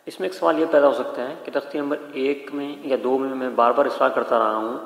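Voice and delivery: usual speaking voice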